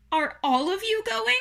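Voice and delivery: high, loud pleading voice; distressed Wilbur. Acted. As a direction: plead anxiously, pitched well up.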